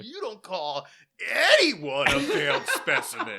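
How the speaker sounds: high, loud, hammed-up outrage; defiant, bullheaded. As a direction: overact a stubborn hero's challenge. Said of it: "as fucking defiant and bullheaded".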